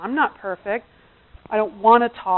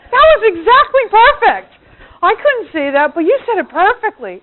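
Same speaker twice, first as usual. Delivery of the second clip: high, loud gushing praise; hammed-up thrilled approval; acted delight; 9 semitones higher, 11 dB louder than usual. Husband praising wife's rant, exuberant acted delight.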